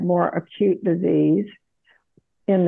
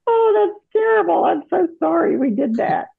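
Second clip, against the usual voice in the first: much higher, mock-shocked apology to her kids; retold dismay; 10 semitones higher than usual. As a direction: replay her own startled sorry, pitched way up. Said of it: "And I went".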